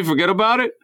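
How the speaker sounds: high, far-off mock New York "Forget about it" with the words run together; mock New York accent. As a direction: echo the phrase back in a jokey New York accent. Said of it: "not in New York".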